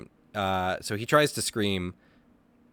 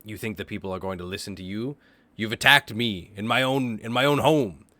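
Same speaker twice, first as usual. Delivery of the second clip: a raised, indignant captive cult leader; angry leader; 6 dB louder than usual. Acted. Captured leader berating his attacker, indignant and loud. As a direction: demand answers, affronted and loud.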